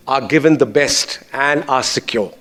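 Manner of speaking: usual speaking voice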